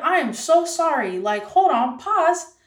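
high, loud retold apology; acted-out urgent "hold on"; frantic apology. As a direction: halt everything with an animated apology.

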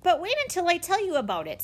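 can't wait to pitch it; eager. Acted. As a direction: campaign man eagerly pressing the children. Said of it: "Mister Winters eagerly".

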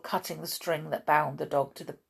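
plain reading voice

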